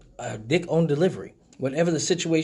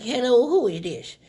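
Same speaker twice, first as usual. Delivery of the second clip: a higher voice, playing a dotty old lady; confused old lady; 8 semitones higher, 3 dB louder than usual. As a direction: pick up the phone like a muddled grandma. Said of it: "I was like".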